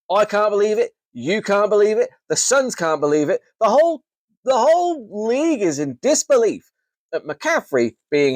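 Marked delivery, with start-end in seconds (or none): 0.1-6.7 mock disbelief. Mock outrage over healthy McCaffrey.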